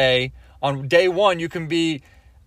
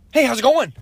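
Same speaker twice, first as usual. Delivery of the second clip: higher, louder hyped-up greeting from a celebrity entrepreneur; Gary Vee; 7 semitones higher, 4 dB louder than usual. Retold or imitated. Gary Vee greeting a fan who wants advice, higher and louder hype. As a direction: bounce in with big hype energy. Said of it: "So this is Gary Vee".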